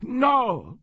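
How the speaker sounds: high sudden cry of denial; sharp cry. Acted. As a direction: blurt out a panicked no. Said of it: "Farnbach cried".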